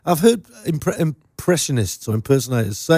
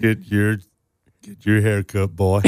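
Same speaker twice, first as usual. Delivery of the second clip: low, slow American cowboy impression; John Wayne; 7 semitones lower, slower than usual. Co-host showing his only American accent, John Wayne impression. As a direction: drop low into an American movie-cowboy drawl. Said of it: "it's just a John Wayne impression".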